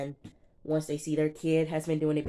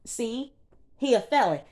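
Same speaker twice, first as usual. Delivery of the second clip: higher, louder told-you-so; mock gotcha; 6 semitones higher, 4 dB louder than usual. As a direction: crow over proving a point. Imitating Brittany's triumphant accusation.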